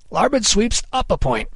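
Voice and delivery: high, carrying captain's command; Kidd calling orders. A narrator's reading. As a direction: call the order across a ship's deck. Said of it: "he called".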